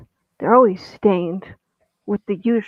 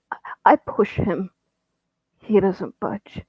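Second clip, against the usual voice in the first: usual speaking voice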